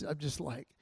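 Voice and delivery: usual speaking voice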